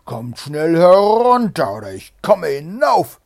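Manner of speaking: roaring Bluebeard, high and very loud; full-force shout. A narrator's reading. Bluebeard yells for his wife to come down, full-force shout. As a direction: bellow the threat with all your might. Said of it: "aus Leibeskräften nach seiner Frau".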